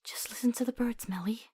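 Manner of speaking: high unvoiced whisper, tender; whispered lullaby. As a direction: hush her to sleep. Lulling the ghost to fade.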